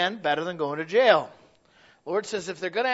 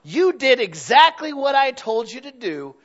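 boss voice; 7 semitones higher, 10 dB louder, slower than usual. Retold or imitated. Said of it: "the boss comes by and he says".

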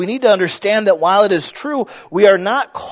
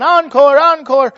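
high, retold crowd cheer calling for more; crowd shouting encore; 9 semitones higher, 7 dB louder than usual. Crowd begging the violinist for more, a high mock cheer. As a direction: call it out like a whole crowd on its feet. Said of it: "there's the crowd again".

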